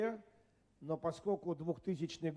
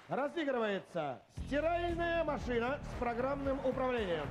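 slow, raised prize announcement; game show announcer; 4 semitones higher, slower than usual. Acted. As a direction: present the prizes like a TV host. Host announces super prizes.

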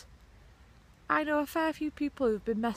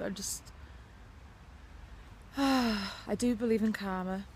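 ordinary talk

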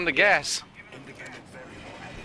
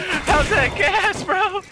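sing-song taunt, much higher; high mocking taunt; 13 semitones higher, slower than usual. Real, not acted. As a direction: tease them, drawn out and high. Teasing enemies, pitch up high.

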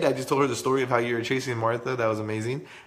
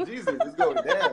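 laughing. Real, not acted. Laughing at Betsy's joke.

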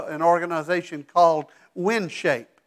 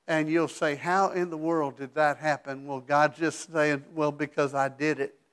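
quiet aside; 3 semitones lower, 7 dB quieter than usual. Acted. Quiet aside about God's answer.

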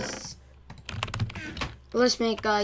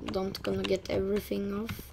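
usual speaking voice

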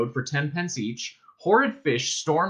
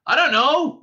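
high, loud yell; 13 semitones higher, 7 dB louder than usual. A narrator's reading. Ford answering Arthur's shout, yelling. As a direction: yell it back over the chaos. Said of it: "yelled Ford".

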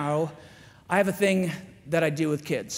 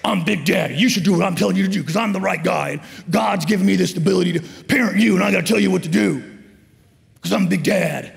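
loud, domineering Big Daddy lecture; mock authoritarian father, booming; deep, loud dad voice; 8 dB louder than usual. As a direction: lay down the law at full volume. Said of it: "you get your deep voice low, and then you start talking really loud".

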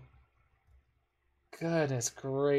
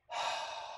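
soft breathy sigh of defeat; deflated sigh; 9 dB quieter than usual. Real, not acted. Player sighing at his own forgotten play, soft and deflated. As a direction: let the air out, beaten. After realizing his mistake.

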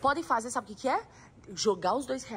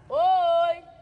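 loud call into the empty hall; 4 semitones higher, 8 dB louder than usual. Real, not acted. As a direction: shout to hear the echo. Testing the echo. (chamando alto)

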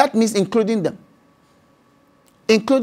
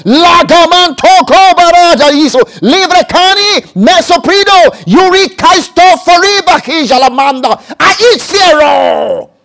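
loud, rising forceful tongues; preacher bursting into loud tongues; bold tongues; 6 semitones higher, 7 dB louder than usual. Shown as a demonstration. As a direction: declare the tongues boldly, building higher. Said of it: "What do you do?".

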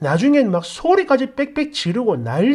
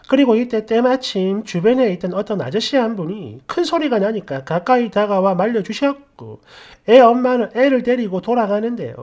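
ordinary delivery, nothing marked

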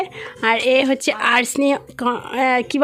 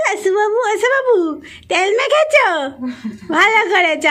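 put-on baby talk; 7 semitones higher, 4 dB louder than usual. Cooing to a little child.

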